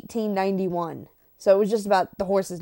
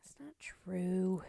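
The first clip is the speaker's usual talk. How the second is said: breathy whispered protest; whispered denial; 4 semitones lower, 12 dB quieter, slower than usual. Real, not acted. Whispering to her co-host that she isn't stuffy. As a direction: whisper it back, half offended. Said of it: "denying she sounds stuffy".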